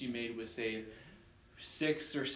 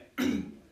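choking throat clear; 5 dB louder than usual. Real, not acted. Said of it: "Sorry, I'm choking on something here".

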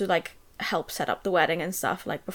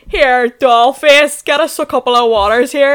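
mocking yell; 4 semitones higher, 11 dB louder than usual. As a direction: ham up the boss calling her over, loud. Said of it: "yell over at some wee girl going".

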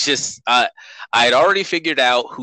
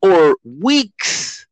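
pitched up in disbelief; rising exasperation, voice climbing high; exasperated; 8 semitones higher than usual. Real, not acted.

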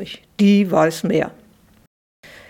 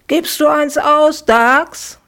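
high, pleading little sister; girl's pleading voice; 8 semitones higher, 4 dB louder, slower than usual. Acted. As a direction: ask sweetly for an ice cream. Sister asks brother, high voice.

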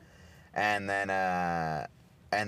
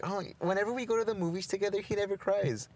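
high, fast mocking voice of a friend; imitating Mati; 8 semitones higher than usual. Retelling what Mati said about movies, high mocking voice. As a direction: play the friend scoffing at crying. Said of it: "Mati was saying".

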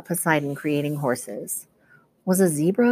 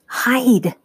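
hissed; 7 semitones higher, 4 dB louder than usual. A narrator's reading. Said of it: "Annabeth hissed".

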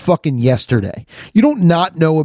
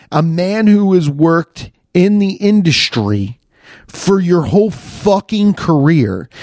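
ordinary delivery

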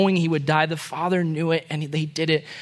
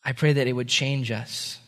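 low, soft, unhurried praying; quiet closing prayer; heartfelt prayer; 4 semitones lower, 4 dB quieter than usual. Real, not acted. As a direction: pray gently, pausing between phrases.

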